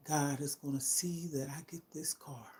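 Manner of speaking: a hushed, hopeful whisper; whispered prayer of hope. Real, not acted. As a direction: whisper it, unvoiced, like a secret wish to God. Speaking hope for a car.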